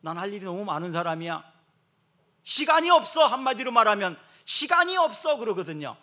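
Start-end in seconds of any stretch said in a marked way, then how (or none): 0.0-3.3 hammed-up brush-off from someone too busy; an imitated busy person snapping that there's no time; mock busy person. Mocking a busy person's excuse. 4.5-5.4 high, loud brush-off; imitated busy person; mock busy person. Quoting busy person again.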